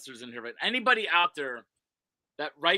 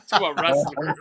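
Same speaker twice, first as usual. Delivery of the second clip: laughing; 9 dB louder than usual. Real, not acted. Laughs at his own viral story.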